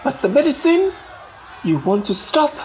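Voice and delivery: high, loud and unbending; voice like iron. A narrator's reading. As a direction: hurl it out as a hard defiant cry. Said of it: "voice like iron".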